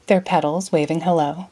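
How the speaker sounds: plain reading voice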